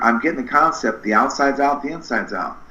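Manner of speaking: ordinary delivery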